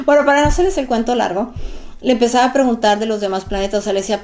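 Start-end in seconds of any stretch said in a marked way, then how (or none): none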